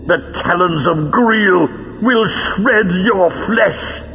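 theatrical high-pitched menace; menacing villain. Acted. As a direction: stretch each threat out slowly and high. Villain threatens his enemies.

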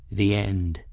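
soft, resigned pronouncement; subdued, burdened old master; heavy, weary. A narrator's reading. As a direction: let it fall softly and wearily. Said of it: "His tone was heavy, weary".

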